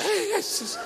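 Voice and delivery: very high, laughing wail; wife's frightened cry. Retold or imitated. Preacher mocking his brave wife's panicked cry. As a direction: squeal it like she lost her nerve. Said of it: "Ini wanita tegar aja udah".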